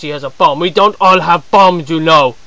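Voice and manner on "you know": ordinary talk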